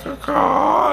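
high cawing bird call; high imitated caw, deadpan; crow caw. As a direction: answer the cops with a crow call. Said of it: "the guy's like".

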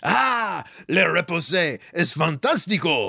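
hammed-up fake French accent; gushing in a mangled, hammy French. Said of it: "Still not French".

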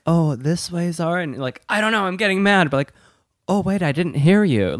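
1 mimicking Steven. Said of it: "He's like".

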